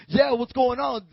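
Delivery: loud, high, confident hail; bold swagger. Acted. Peter among the disciples, bold swagger. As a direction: throw it out like you own the street. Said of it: "They were bold".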